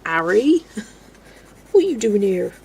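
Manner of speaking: West Country accent, playful character imitation; Hagrid. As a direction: put on a broad South West English accent. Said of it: "known as the Hagrid accent".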